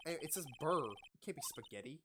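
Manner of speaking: usual speaking voice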